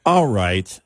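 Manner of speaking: imitated weary, exasperated phone agent; frustrated automated voice. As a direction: say it like a fed-up phone menu. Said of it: "that frustrated tone".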